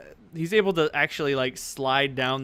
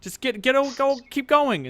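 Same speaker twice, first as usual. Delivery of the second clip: high-pitched, exasperated character voice; flustered guard; 8 semitones higher, 4 dB louder than usual. Acted. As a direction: hurry smelly visitors along. Disgusted guard waves party through.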